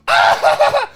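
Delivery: high, loud startled yelp; sudden scream. Retold or imitated. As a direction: let out a sudden shriek. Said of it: "screaming and yelling randomly".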